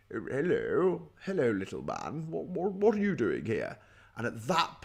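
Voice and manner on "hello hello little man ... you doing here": plummy posh English toff voice; posh accent. Acted. Posh stranger greets a little man, mock plummy posh accent. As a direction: condescend in an exaggerated posh accent. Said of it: "someone with a posh accent comes along".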